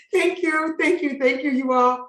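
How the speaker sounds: laughing, elated thank-yous; delighted thanks. Real, not acted. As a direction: gush thanks at the host. Overwhelmed thanks after praise.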